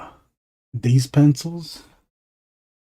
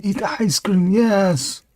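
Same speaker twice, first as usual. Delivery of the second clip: higher sing-song coaxing; pitched-up cooing encouragement; 8 semitones higher, 5 dB louder, slower than usual. Acted. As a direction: coo it up high, like urging a little one to eat. Coaxing someone to eat.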